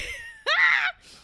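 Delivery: high, loud burst of laughter. Real, not acted. Venting to viewers, a loud high laugh.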